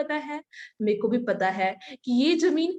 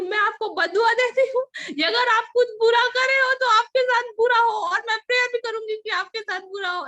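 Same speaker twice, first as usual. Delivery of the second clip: much higher and louder, wild outburst; retold furious curse; 10 semitones higher, 6 dB louder than usual. She turns back to curse the uncle, furious shout. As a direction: turn back and fling the curse.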